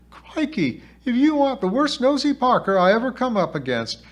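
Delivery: put-on high, loud, put-upon girl's voice; exasperated high-pitched outburst; feisty spirit girl, exasperated.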